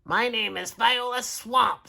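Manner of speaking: high, scratchy witchy substitute. A narrator's reading. Substitute introducing herself to the class, scratchy. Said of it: "said the lady in a scratchy voice".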